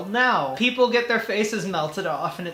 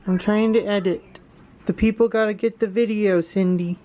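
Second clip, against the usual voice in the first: fond pet scolding; 3 dB louder than usual. Real, not acted. Fond scolding of cat on lap.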